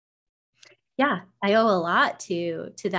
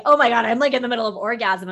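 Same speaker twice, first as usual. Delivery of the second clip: a louder, fast excited blurt; excited inner exclamation; 3 semitones higher, 6 dB louder, faster than usual. Retold or imitated. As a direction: blurt it out in amazement, quick and loud. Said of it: "And I was like".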